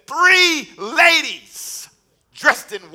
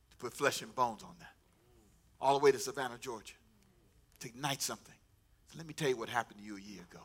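soft, low, intimate sermon voice; hushed preaching; 9 semitones lower, 12 dB quieter than usual. Acted. Preacher hushing the young people to testify quietly. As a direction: draw the congregation in close, softly.

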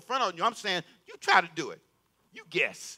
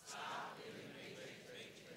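whisper; 24 dB quieter than usual. Shown as a demonstration. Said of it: "Say that with me".

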